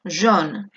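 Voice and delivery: jaune said with AU as a round O. Shown as a demonstration. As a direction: round your lips and say jaune with O. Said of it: "se va a pronunciar como una O". (jaune con sonido de O)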